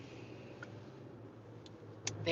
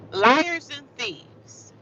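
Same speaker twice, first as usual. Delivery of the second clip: drawn out, higher, louder; slow dramatic intoning; 6 semitones higher, 4 dB louder, much slower than usual. Acted. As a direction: lay the accusation on slowly.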